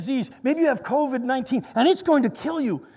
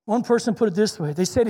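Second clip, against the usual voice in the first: usual speaking voice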